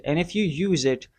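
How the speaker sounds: usual speaking voice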